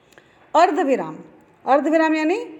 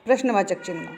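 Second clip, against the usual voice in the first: normal talking voice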